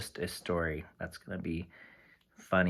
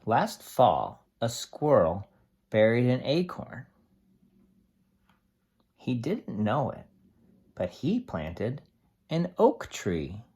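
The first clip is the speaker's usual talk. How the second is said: steady storybook read-aloud for a class; reading to children. Acted. Teacher reading a tree book to the class, steady storybook voice.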